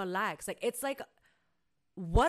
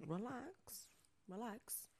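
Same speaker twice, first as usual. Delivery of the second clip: much softer, gentle relax relax; calm her down like a child; hushed reassuring relax; soothing like to a kid; 16 dB quieter, slower than usual. Retold or imitated. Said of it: "Tactics that I have to use with kids".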